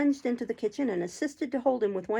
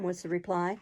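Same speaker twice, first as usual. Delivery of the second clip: ordinary delivery, nothing marked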